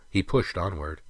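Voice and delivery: ordinary delivery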